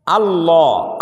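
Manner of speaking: "আল্লাহ" with a short, unstretched opening a; short alif. Shown as a demonstration. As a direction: clip the first a of "আল্লাহ" short, the right way. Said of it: "আ টান নেই".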